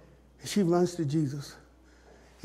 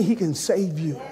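ordinary talk

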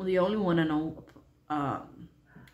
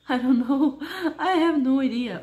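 laughing; 7 semitones higher, 6 dB louder than usual. Real, not acted. Laughs at not knowing.

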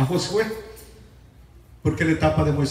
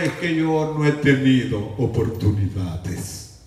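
a low, slow, whiny excuse to the pastor; lightly voiced sulking churchgoer; complaining congregant; 5 semitones lower than usual. Congregant making excuses to the pastor, low and slow. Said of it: "Sí, pastor".

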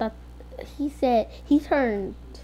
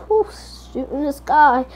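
ordinary talk

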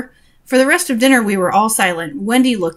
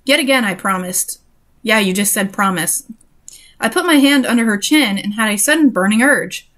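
usual speaking voice

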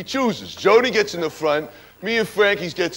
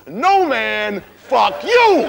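retold furious yell, higher and louder; shouting; 6 semitones higher, 5 dB louder, much slower than usual. Driver pulls a gun on Frankie, furious shout. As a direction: yell it back at Frankie over a pointed gun.

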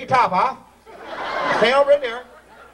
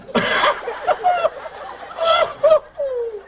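shrieking high laugh. Real, not acted. Emcee cracks up at skinny volunteer, squealing laugh. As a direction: burst into a squealing cackle.

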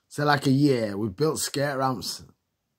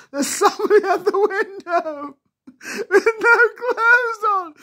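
very high, loud screaming, half crying with laughter; 8 dB louder than usual. Retold or imitated. Kids all screaming to John about the naked man, shrieking. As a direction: shriek it, laughing and scared at once. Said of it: "And we're all screaming".

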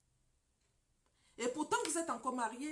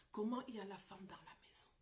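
whispered; 3 semitones lower, 5 dB quieter than usual. Retold or imitated. Wife's retold complaint.

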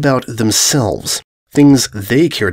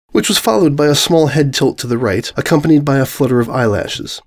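ordinary delivery, nothing marked

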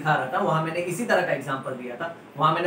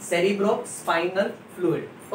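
slow dictation; much slower than usual. Shown as a demonstration. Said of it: "repeats term for dictation".

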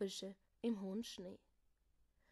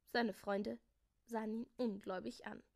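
ordinary delivery

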